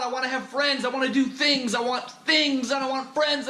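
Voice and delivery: high, strained rant of pent-up frustration; frustrated outburst. Retold or imitated. Alone in his apartment on Friday night, ranting in high-pitched frustration. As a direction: let all the lonely anger burst out at once. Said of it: "this anger, this frustration".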